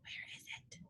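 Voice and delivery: breathy unvoiced whisper. A narrator's reading. Pooh whispering to Christopher Robin, unvoiced. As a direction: lean in and barely breathe it.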